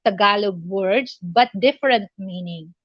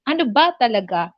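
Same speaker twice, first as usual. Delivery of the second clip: quick "Ano ba talaga" with BA stressed, at usual pitch; neutral asking accent; much faster than usual. Shown as a demonstration. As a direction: simply ask "Ano ba talaga", leaning on ba. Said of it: "that's the accent".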